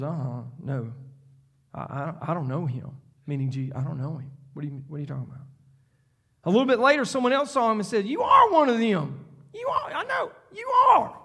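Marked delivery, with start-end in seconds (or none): 0.0-5.5 softer and lower, stammering I, I, I; nervous denial. Acted. Peter denying Jesus to a servant girl, softer nervous stammer. 8.1-11.2 much higher, pointed, accusing voice; accusing bystander. Acted. Bystander accusing Peter by the fire, high pointed accusation.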